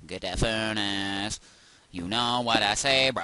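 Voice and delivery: loud, drawn-out goofy voice; silly put-on voice. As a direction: stretch it out loudly as a daft bit. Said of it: "I don't know what that was".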